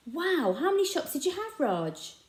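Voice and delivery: high, wide-eyed wow; impressed boy. A narrator's reading. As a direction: sound amazed. Joe impressed.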